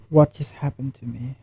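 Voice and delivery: low, soft, shaken; quiet stunned fear; really scared. Real, not acted. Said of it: "I'm really scared".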